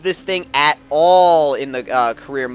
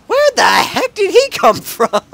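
much higher, loud laughing surprise; startled disbelief; 5 dB louder than usual. Real, not acted. Gamer shocked by a car suddenly appearing, high laughing disbelief. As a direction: yelp it out, caught off guard.

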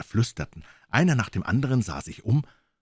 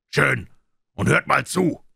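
Silver cuts in, a touch louder; ship's cook taking charge; 6 semitones higher, 5 dB louder, slower than usual. Acted. Silver the ship's cook takes the floor. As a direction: grab their attention. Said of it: "sagte der Schiffskoch".

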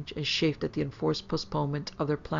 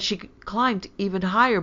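higher, louder excited boast; triumphant; 4 semitones higher, 5 dB louder than usual. A narrator's reading. Daughter bragging to mother, triumphant. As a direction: blurt it out proudly. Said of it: "cried Hyacinth triumphantly".

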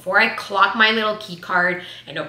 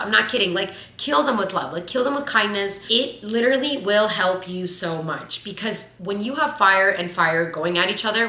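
ordinary delivery

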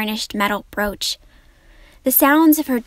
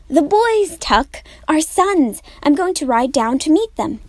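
eager and higher, a bit louder; excited; 6 semitones higher, 4 dB louder than usual. Acted. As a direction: bubble over with eagerness. May eager to ride out.

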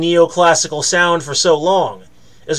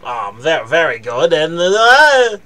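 nasal-free nerdy geek voice, lightly done; nerd voice; 4 semitones lower than usual. Pretend. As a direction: play a dorky nerd praising something. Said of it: "doing my nerd voice".

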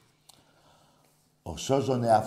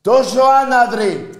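very high, drawn-out furious scream; all-out outraged bellow; screamed insult; 17 dB louder than usual. Real, not acted. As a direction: stretch it out at the top of your voice. Repeats the insult at full scream.

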